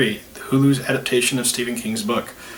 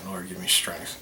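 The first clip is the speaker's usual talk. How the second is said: praying for patience through teeth; 6 semitones lower, 11 dB quieter than usual. Real, not acted. Exasperated aside during bloopers.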